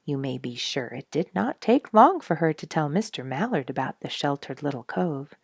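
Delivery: plain reading voice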